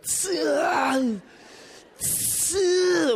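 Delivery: straining lift grunt, high and sharp; grunting weightlifter. Retold or imitated. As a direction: grunt like lifting heavy.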